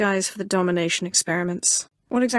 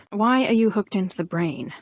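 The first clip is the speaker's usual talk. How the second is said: ordinary delivery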